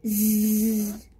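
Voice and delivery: a low drawn-out snore; snoring. Acted. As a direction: snore as if fast asleep. Character falls asleep snoring.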